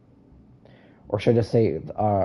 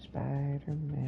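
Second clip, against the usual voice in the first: whispering; 3 semitones higher, 10 dB quieter than usual. Pretend. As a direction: whisper it like you're sneaking. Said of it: "I have to whisper".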